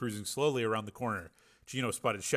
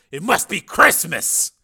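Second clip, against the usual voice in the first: gloating; 7 semitones higher, 12 dB louder, slower than usual. A narrator's reading. Hero gloating.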